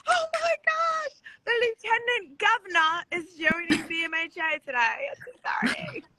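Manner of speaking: high-pitched, gushing mock British; over-the-top British-ish squeal of excitement; mock British accent. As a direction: exclaim in a badly faked British accent. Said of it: "is that your Brit".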